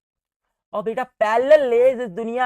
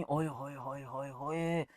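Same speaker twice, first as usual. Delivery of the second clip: mock lament; 5 semitones lower, 13 dB quieter, slower than usual.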